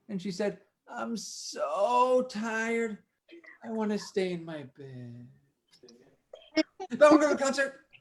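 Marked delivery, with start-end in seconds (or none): 0.9-5.3 very slow, drowsy groaning words; sleepy Clara. Acted. 6.9-7.9 higher and louder, bright and eager; eager Clara. Acted. Clara torn, eager half.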